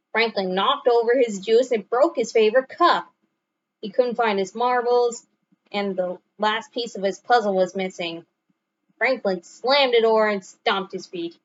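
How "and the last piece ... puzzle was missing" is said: ordinary delivery, nothing marked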